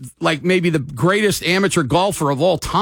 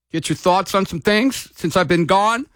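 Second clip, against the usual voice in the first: ordinary talk, nothing marked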